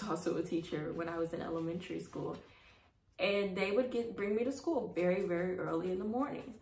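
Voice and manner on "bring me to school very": plain reading voice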